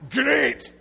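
put-on shouting Scottish accent berating a lost driver; abusive Scottish GPS. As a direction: yell at the driver like an angry Scottish sat-nav. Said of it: "an abusive G P S in a Scottish accent".

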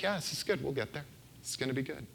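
God's easy reassurance. Pretend. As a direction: let God sound gently unbothered. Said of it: "he seems to be like".

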